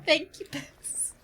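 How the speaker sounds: normal talking voice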